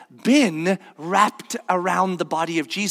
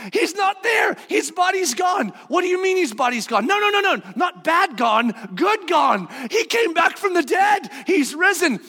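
high, shouted back and forth; excited shouting; 9 semitones higher than usual. Acted. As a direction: shout the news breathlessly. Excited exchange women disciples.

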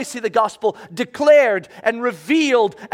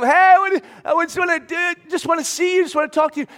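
high, raised voice calling from a distance; calling out from afar; 7 semitones higher, 4 dB louder, faster than usual. Retold or imitated. Lepers calling to Jesus from a distance, raised high shout. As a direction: reach across the distance with the call. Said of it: "And they're shouting to him".

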